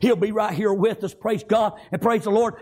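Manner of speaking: normal talking voice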